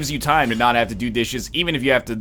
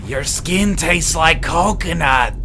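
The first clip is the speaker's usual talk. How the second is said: high, loud, slow character voice; Little Nicky; 5 semitones higher, 5 dB louder, slower than usual. Acted. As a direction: draw it out in a put-on movie-character voice. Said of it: "as Little Nicky".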